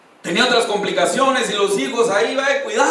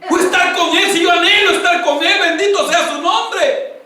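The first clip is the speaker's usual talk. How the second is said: high, loud exultant cry; jubilant praise; 8 semitones higher, 4 dB louder than usual. Acted. Believer told of coming death, jubilant praise. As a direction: rejoice out loud at meeting God.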